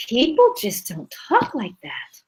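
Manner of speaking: breathy, slow disbelieving whisper; exasperated inner thought. Retold or imitated. Frustrated reader thinking to herself, a slow whisper. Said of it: "stumble across stiff dialogue and think".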